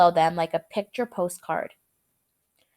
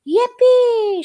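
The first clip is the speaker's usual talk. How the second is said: very high, loud whoop; joyful shout; 9 dB louder than usual. A narrator's reading. Grandpa Joe cheers again as they speed up, loud whoop. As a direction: whoop like a delighted kid. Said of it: "shouted Grandpa Joe again".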